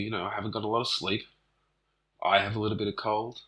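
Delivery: ordinary talk, nothing marked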